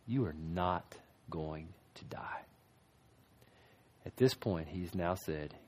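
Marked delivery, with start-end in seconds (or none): 0.1-2.4 slow whisper, soft and sly; tempting whisper. Acted. Preacher playing the serpent's whisper to Eve. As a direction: slowly whisper the lie to lure her.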